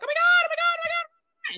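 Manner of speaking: high shrieking oh my God, hammed up; mock terrified screams. Recapping the movie, a friend mimics screaming kids. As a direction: shriek it like a horror movie victim.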